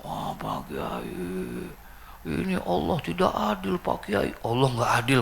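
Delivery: mock wailing of a bereaved husband; imitated weeping. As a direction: moan it drawn out, then lift into a high lament. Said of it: "nangisnya bukan main tuh laki".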